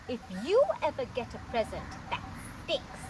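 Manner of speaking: usual speaking voice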